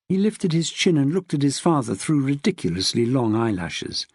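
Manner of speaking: usual speaking voice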